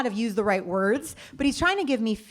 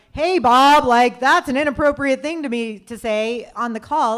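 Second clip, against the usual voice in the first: mock call-out; 3 semitones higher, 8 dB louder than usual. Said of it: "I didn't sit there and be like".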